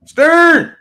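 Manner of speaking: calling out. Real, not acted. Calling out across the room.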